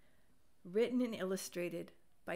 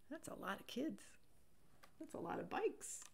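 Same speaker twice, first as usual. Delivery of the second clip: hushed aside; 4 semitones higher, 11 dB quieter than usual. Real, not acted. Reader softly noting all the kids and bikes. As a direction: murmur it to the listener.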